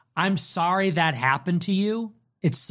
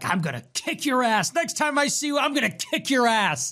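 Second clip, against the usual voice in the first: mock threat; 8 semitones higher than usual. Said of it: "He was like".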